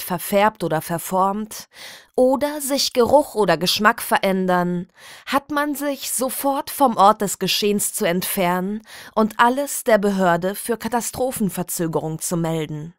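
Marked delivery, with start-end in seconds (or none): none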